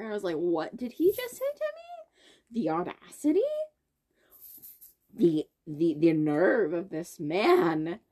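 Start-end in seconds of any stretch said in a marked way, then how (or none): 0.4-2.6 high, slow, stunned disbelief; shocked. Retold or imitated. Girl retelling her shock at the insult, stunned. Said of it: "I was more in shock than anger". 2.6-8.0 lower, louder, drawn-out indignation; shocked outrage. Retold or imitated. Girl retelling her reaction to the insult, shocked outrage. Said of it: "I was more in shock than anger".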